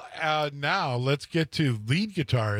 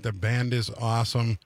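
usual speaking voice

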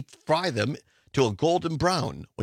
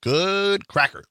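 slow, higher folksy drawl; Andy Taylor; 6 semitones higher, 4 dB louder, slower than usual. Retold or imitated. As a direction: drawl it like a small-town sheriff. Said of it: "As Andy Taylor once said".

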